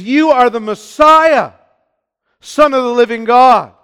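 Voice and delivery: preacher declaring Peter's confession; proclaimed confession. Retold or imitated. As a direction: proclaim it as the rock of faith. The confession the church is built on.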